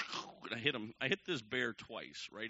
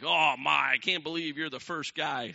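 loud, raised-pitch astonishment; mock amazement; 4 semitones higher, 10 dB louder than usual. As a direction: act out a group's loud disbelief. Group amazed at his kill.

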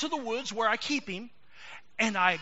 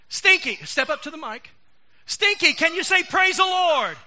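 loud coaxing; 6 semitones higher, 9 dB louder than usual. Acted. Ventriloquist coaxing puppet loudly.

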